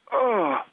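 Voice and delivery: sharp high yelp of pain; pained cry. Retold or imitated. Patient yelping at the biopsy pressure, a high pained oh. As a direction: cry out as the needle goes in.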